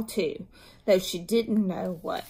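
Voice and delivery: plain reading voice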